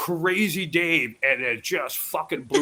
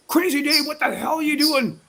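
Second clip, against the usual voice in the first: mock yell; 10 semitones higher than usual. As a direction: shout it up high like an annoyed guest. Partygoers yelling at him.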